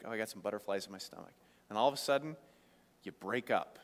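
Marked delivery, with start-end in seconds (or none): 0.0-1.3 soft, quick lovesick aside; mock lovesick sigh. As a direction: play the teenager with butterflies, hushed. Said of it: "you're like".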